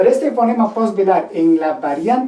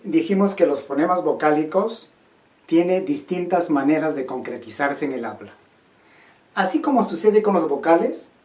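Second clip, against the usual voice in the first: ordinary talk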